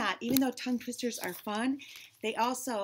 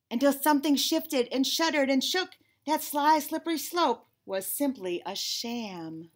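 loud, raised, emphatic narration; dramatic story reveal; 4 semitones higher, 6 dB louder than usual. Acted. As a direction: punch each alliterative word, then ease down.

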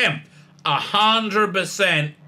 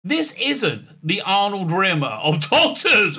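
incredulous; 5 semitones higher than usual. Real, not acted. Incredulous rant at the Doctor.

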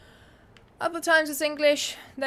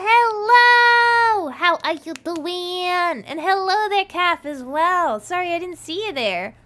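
loud, high, drawn-out hello; big booming welcome, stretched words; excited greeting; 5 semitones higher, 12 dB louder, slower than usual. Real, not acted. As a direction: call out joyfully to friends just arriving. Greeting arriving viewers.

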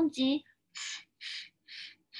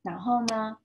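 plain reading voice

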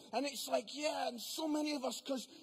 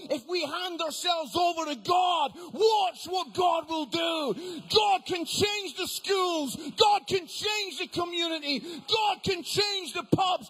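loud, raised, emphatic sermon shouting; full-force preaching; 4 semitones higher, 10 dB louder than usual. Real, not acted. Preacher rousing the congregation about what God can do, full-force preaching. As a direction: build loud, driving repetitions, then come down at the close.

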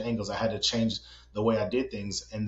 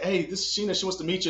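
high-pitched, eager introduction; raised voice, pitched up to make an introduction; calling someone over; 8 semitones higher than usual. Retold or imitated. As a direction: re-enact hailing a friend to meet someone. Re-enacts calling friend over for introduction.

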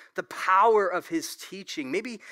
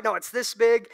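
bragging fisherman; 6 semitones higher, 7 dB louder than usual. Retold or imitated. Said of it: "Usually it's like".

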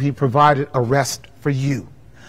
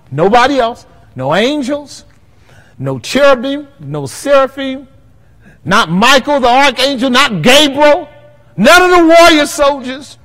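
rising louder and higher list; building preacher's cry; 9 semitones higher, 5 dB louder than usual. Acted. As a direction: climb to a shout over the list.

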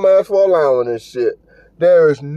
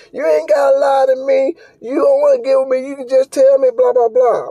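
higher, whiny mock complaint; mocking tall woman; 6 semitones higher than usual. The rejected woman at Walmart, higher whiny mock rant. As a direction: imitate her going off, nagging. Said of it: "She was like".

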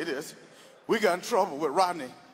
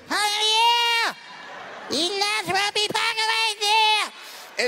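other driver voice; 11 semitones higher, slower than usual. Retold or imitated. Driver shouting back about the spot, high and slow. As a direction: stretch it high and mocking.

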